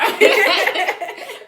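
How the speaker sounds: real laugh of relief; loud laugh. Real, not acted. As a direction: burst out laughing, high and loud. Joy at finally going live.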